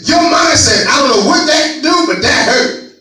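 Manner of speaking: high, loud preaching climb; loud preaching at full force. Real, not acted. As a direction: preach it hard to the congregation.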